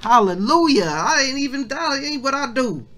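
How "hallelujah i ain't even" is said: ordinary talk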